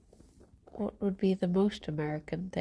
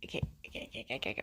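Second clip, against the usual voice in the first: whispering; 8 semitones lower, 12 dB quieter, much faster than usual. Real, not acted. Quietly soothing a bunny.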